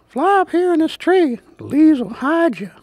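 very high bird voice; calling bird. A narrator's reading. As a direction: call down high-pitched like a bird. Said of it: "a bird called".

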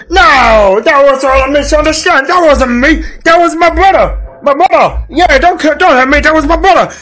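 high, whiny put-on excuse; hammed-up monster plea; pleading monster. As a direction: beg off blame in a silly high voice. Monster Lawrence pleads innocence.

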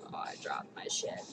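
breathy whisper to herself; whispered aside. Real, not acted. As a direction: whisper it under your breath. Dropped something, aside.

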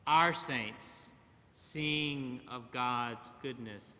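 ordinary talk, nothing marked

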